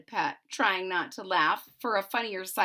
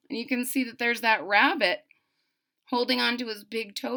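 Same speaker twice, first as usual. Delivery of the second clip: ordinary delivery, nothing marked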